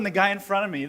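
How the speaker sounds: ordinary talk, nothing marked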